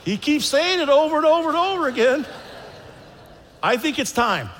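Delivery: hammed-up desperate prayer; mock prayer. Praying for the yelling patient, in mock desperation. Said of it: "I began to pray for something else".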